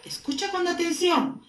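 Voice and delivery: normal talking voice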